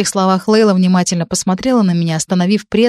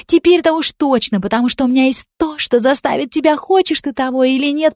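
put-on angry girl threatening Dan to his face, higher; venomous threat; 6 semitones higher than usual. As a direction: threaten him like a snake, pitched up. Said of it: "прошипела прямо ему в лицо".

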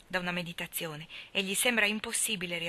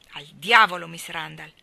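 louder, a sudden curse; angry outburst; 13 dB louder than usual. Acted. Lori bursts out against Miss Randall, angry. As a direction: spit out the curse in anger.

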